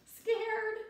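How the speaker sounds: a high, startled gasp of a word; scared. Acted. Teacher reading a children's book acts out a scared cry. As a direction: say it like a jolt of fright. Emotion word voiced for a fearful picture.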